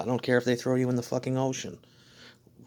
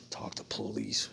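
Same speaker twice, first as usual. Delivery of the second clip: much lower, softer whispered "Talk to police"; mocking whisper; 10 dB quieter, slower than usual. Mocking the people who warn him, whispered. Mocks the warning he keeps hearing.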